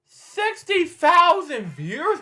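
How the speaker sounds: high, loud, slow disbelief; astonished. Real, not acted. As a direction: stretch it out in amazed disbelief. Astonished at view count.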